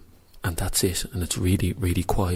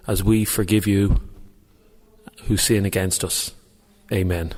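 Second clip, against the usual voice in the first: loud, halting recital of the Our Father; reciting a prayer aloud; 2 semitones higher, 5 dB louder than usual. Shown as a demonstration. Praying aloud in a church for a radio challenge, loud prayer recital. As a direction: pray it out loud in a church, as loud as you dare. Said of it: "So it's the Our Father first".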